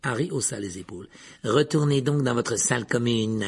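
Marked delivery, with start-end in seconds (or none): 1.4-3.4 put-on, drawn caretaker voice; hostile caretaker. A narrator's reading. Caretaker orders Harry back, hostile. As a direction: send the student away with hostility. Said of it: "lança Rusard".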